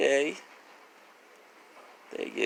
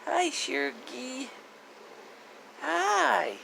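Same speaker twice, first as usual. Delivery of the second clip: much higher cooing greeting; high pet talk; 3 dB quieter, slower than usual. Real, not acted. Owner saying hi to her dog, high cooing pet voice. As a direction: lift it up into a sweet pet voice.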